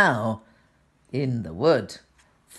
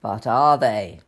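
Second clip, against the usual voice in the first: ordinary delivery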